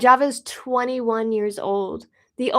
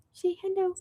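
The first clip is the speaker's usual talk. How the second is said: higher, softer coaxing voice; pet talk; 7 semitones higher, 7 dB quieter than usual. Real, not acted. Coaxing her cat to greet viewers, high and soft. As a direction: sweetly coax the cat.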